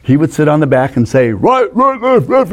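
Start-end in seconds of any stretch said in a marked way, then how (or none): 1.3-2.5 high-pitched squeaky backseat directions. Retold or imitated. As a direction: imitate a big guy's little squeaky voice. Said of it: "a little high squeaky voice".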